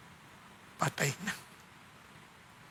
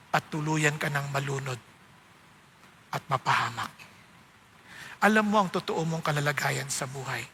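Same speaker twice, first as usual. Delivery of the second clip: soft, low preaching over music; altar call; 4 semitones lower, 4 dB quieter than usual. Acted. Preacher calls the congregation to accept rescue, soft and low. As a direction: gently invite them to surrender.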